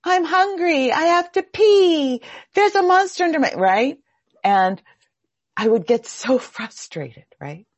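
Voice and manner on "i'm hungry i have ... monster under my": put-on high, loud whining little kid; a parent mimics a toddler's high whiny excuses. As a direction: play the toddler stalling bedtime. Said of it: "a three or a four-year-old coming up with every excuse".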